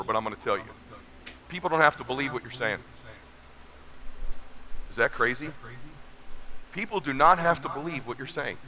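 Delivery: low, soft, confiding voice to the audience; hushed confiding. Real, not acted. Speaker letting the crowd in on a secret, hushed and soft. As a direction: lean in and let them in on a secret.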